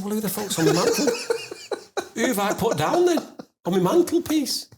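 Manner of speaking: high, half-laughing disbelief; incredulous. Retold or imitated. Owner learning the ashes are wrong, high comic disbelief. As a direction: ask it high, baffled and on the edge of laughter.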